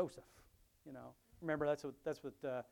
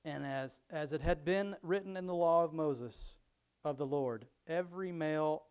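louder, measured Bible reading voice; scripture reading; 7 dB louder than usual. Acted. Pastor reading Luke aloud to his congregation, louder reading voice. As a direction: read the passage out to the congregation.

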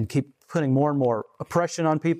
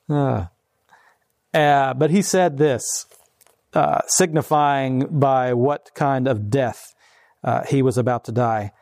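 ordinary talk

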